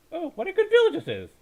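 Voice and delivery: loud, high cry of delight; delighted exclamation. Acted. Hussein, pleased by the graveyard feast, cries out loud and high. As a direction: burst out with joy at the food.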